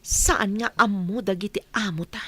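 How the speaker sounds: ordinary delivery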